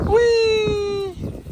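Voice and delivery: long, very high whee; playful squeal. Real, not acted. Owner playing with Bambi, high playful squeal.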